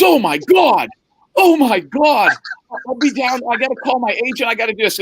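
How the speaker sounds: high and breathless with joy; excited rookie. Retold or imitated. Rookie gets the scout's call, gushing high. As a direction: gush it, can't believe his luck.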